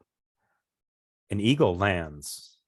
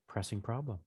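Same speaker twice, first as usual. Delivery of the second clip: hushed musing; 13 dB quieter than usual. Acted. Mouse mulling over the player's answer, hushed musing. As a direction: repeat it musingly, barely audible. Mouse repeats it under its breath.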